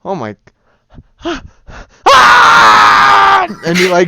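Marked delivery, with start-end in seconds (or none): 2.1-3.5 a high, loud startled scream; sudden scream. Acted. Swordsman shrieks as Chucky attacks under his skirt, loud and high. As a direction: yelp as if something bit you.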